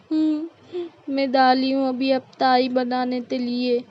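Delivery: put-on babyish lisp, arguing back meekly; childlike, aggrieved lisp; lisping daughter-in-law. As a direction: reproach her with l for r and t for k. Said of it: "इतना तोतला बोल रही हो".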